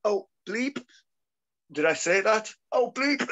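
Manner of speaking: high, rising mock dismay; retold shocked blurting. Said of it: "I said it again because I was like".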